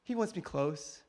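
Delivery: ordinary talk, nothing marked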